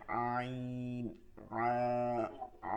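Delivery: "আ" squeezed thin from the throat, held long; low, softly squeezed "আইন"; soft pressed ain. Shown as a demonstration. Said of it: "চাপ দিয়া চিকন আওয়াজে".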